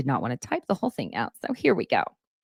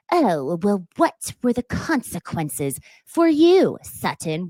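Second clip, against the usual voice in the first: pointed question; 2 semitones higher, slower than usual. Retold or imitated. Dorit questioning Sutton, imitated in a slow pointed voice. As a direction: needle her with a sweetly pointed tone. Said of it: "I'd say pointed way".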